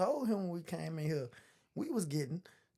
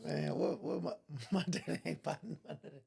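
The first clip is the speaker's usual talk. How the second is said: laughing; 3 semitones lower, 6 dB quieter than usual. Real, not acted. Laughing while talking.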